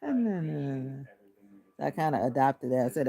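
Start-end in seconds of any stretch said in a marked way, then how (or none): 0.0-1.0 flat, even da-da-da drone; lightly imitated monotone chatter; monotone babble. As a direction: drone the syllables like a bored worker. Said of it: "Everything just sounded like".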